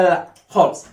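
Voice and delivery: ordinary delivery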